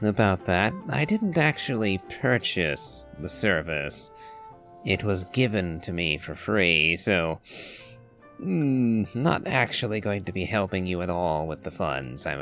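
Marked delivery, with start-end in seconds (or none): none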